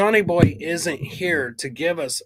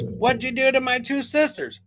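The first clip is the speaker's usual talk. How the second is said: put-on high child voice, demanding; kid's indignant question; mock five-year-old; 9 semitones higher than usual. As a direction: imitate an upset little kid, pitched way up. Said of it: "The five-year-old comes down".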